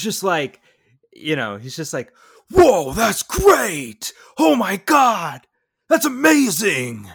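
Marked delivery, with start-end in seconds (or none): 2.5-7.1 much higher, much louder and slow, gushing hype; over-the-top hammed-up hype man. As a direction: cheer him on like his biggest hype man. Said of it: "he is such a hype man".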